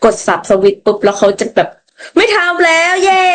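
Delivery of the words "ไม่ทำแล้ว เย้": put-on high, very slow sing-song child cheer; cheery little kid. A child pretending to reform instantly, high slow cheer. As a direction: cheer like a kid who changed overnight.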